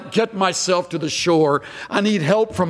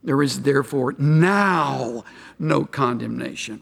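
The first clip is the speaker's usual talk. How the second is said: "now" stretched out and set apart, in a lower voice; stress on now; 6 semitones lower than usual. Shown as a demonstration. Preacher pointing out a little word, stress on "now". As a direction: lean long on "now", then go on. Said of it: "See the word now?".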